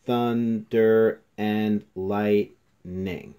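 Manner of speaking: syllable by syllable. Shown as a demonstration. Teacher checking a haiku's five syllables, "Thunder and lightning" syllable by syllable. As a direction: THUNDER AND LIGHTNING drawn out, each syllable separate and loud. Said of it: "So let's go over that".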